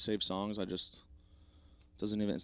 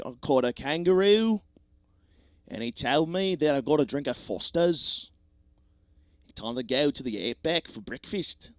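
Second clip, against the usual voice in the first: higher, louder hammed-up Australian accent; bad Australian accent; 3 semitones higher, 7 dB louder than usual. Shown as a demonstration. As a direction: overdo a cartoon Australian accent. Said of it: "can you sing an Australian accent".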